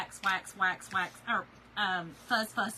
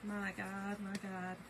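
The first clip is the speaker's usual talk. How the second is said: quiet disbelief; 3 semitones lower, 7 dB quieter, slower than usual. Retold or imitated. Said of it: "I was like".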